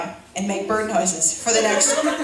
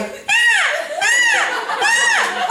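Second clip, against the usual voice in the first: high, pitched-up "ah" wails; hammed-up childish whimpers; mock distress cries; much slower than usual. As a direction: wail like a panicked kid. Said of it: "sitting in the back going".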